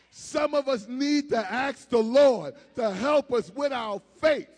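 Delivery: loud, high-pitched sermon delivery; full-force preaching. Real, not acted. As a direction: preach it out at full power to the congregation.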